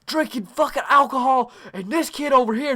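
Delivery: high-pitched ranting, imitating a mother; acted frantic mom tirade; freaking-out mom. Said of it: "she's freaking out".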